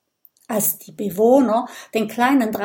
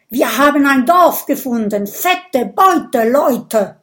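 loud, higher-pitched scouts' shout; calling out; 4 semitones higher, 8 dB louder than usual. A narrator's reading. Scouts report a village to plunder, calling out. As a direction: call out the big news. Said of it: "zurück und rufen".

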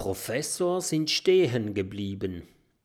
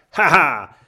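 loud robber's "haha"; acted villain's chuckle, louder; gloating laugh; 5 semitones higher, 12 dB louder than usual. A narrator's reading. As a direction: laugh out triumphantly. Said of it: "grinst und sagt".

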